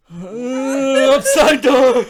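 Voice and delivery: high, loud, very stretched-out laughing words; laughing drawl. Real, not acted. Drawn-out repeat, bursting into laughter.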